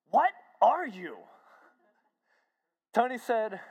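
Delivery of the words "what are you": loud, high, slow questioning; retold bewildered demand. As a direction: ask as a dumbfounded Harry. Harry baffled after the prayer.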